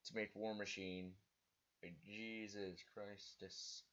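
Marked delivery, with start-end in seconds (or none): 1.8-3.8 burp rolling through the words; slow, burped-out words. Real, not acted. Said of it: "Sorry, I burped".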